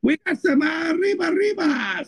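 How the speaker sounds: mock Mexican accent. Mocking the 911 caller with a shouted Mexican accent. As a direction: yell it in a jokey Mexican accent.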